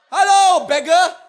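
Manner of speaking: high, loud jeering hello, beggar; mocking shout. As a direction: yell the insult, jeering. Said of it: "So beggar".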